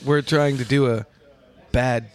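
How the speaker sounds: slow, with pauses in odd places; playfully broken-up phrasing; bad halting impression. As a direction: stall before each key word. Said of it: "that's what it's like".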